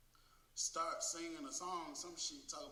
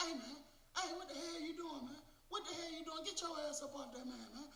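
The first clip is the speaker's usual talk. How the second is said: very high-pitched orders, little added volume; imitated alarmed officers. Officers finding inmate on top of man, imitated high-pitched alarmed orders.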